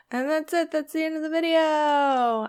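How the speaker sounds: sing-song it as a hammed-up outro; mock sign-off.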